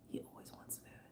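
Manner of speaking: breathy whisper under her breath; whispered aside. Real, not acted. Aside to viewers about the pet, whispered. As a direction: whisper it so he won't hear.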